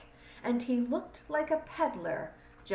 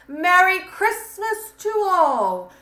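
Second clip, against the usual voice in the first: loud, higher festive exclamation, slower at the end; exclaiming; 3 semitones higher, 10 dB louder than usual. Acted. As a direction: call out a farewell while driving off. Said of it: "I heard him exclaim".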